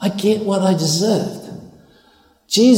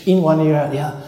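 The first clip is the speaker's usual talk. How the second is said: ordinary delivery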